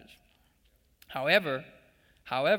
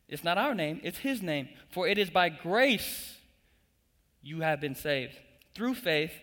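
full-force preaching; 4 semitones higher than usual. Acted. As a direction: drive it home to the congregation. Preaching climax.

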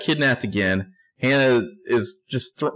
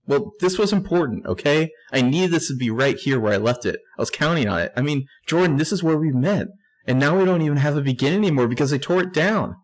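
voicing Hannah; 6 semitones higher than usual.

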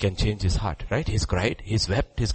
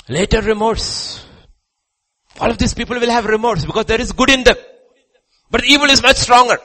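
loud, high-pitched pulpit shout; full-force preaching; 11 dB louder than usual. Acted. Preacher warning the congregation that evil is stronger, full-force shout. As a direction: preach it at the top of your voice.

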